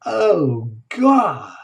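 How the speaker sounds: overwhelmed. Real, not acted. Overwhelmed by the reading.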